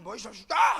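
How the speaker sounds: loud shouted retort; hammed-up angry shout; shouted curse back. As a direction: yell it right back at him. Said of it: "이렇게 욕을 나오는 게".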